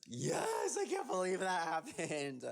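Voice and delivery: high-pitched, thrilled outburst; excited gushing. Real, not acted. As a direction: gush in a high squeal of delight. Gushing reply about premiere night.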